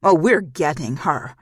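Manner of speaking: loud, firm threat; a loud menacing vow; menacing insistence. Acted. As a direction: lean hard into the threat.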